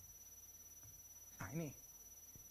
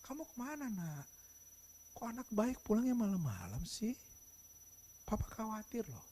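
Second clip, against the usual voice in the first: soft, worried fatherly voice; hushed, caring parental concern; Christlike gentleness; 8 dB quieter than usual. Shown as a demonstration. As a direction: ask tenderly, like a concerned dad. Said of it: "yang kedua menunjukkan karakter Kristus".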